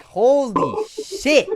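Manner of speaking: shrieked exclamation, very high and loud; high, loud shocked shriek; screaming. Acted. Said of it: "fucking screams".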